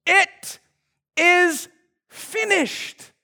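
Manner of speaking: slow, spaced out words, each one set apart; hushed weighty finality; solemn last words. Acted. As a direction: let each word land alone. Jesus' last words on cross.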